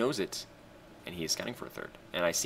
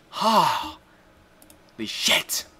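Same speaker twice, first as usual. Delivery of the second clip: very slow, higher holy shit; slow stunned exclamation; 7 semitones higher, much slower than usual. Real, not acted. Gamer amazed the opponent cut drones, slow disbelief. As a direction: draw it out in disbelief.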